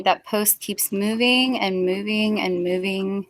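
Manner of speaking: slow, stretched "moving" each time; drawn-out repetition. Real, not acted. Friend complaining about shifting goalposts, drawn-out "moving". Drawing out repeated word for effect.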